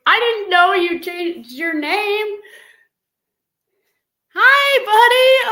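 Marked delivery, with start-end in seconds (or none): 4.3-5.5 high, loud, slow emotional hello; tearful greeting. Real, not acted. Mother greeting her son on the stream, tearful and thrilled. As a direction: greet him through happy tears.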